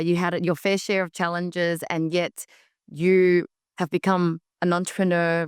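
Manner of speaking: usual speaking voice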